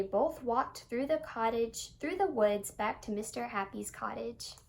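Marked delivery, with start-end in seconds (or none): none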